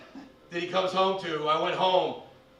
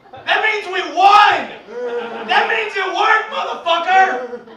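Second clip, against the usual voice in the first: yelling with glee, much higher and louder; triumphant shout; 11 semitones higher, 10 dB louder, slower than usual. Retold or imitated. Telling the crowd the cops came, gleeful shout. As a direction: roar it like you just won.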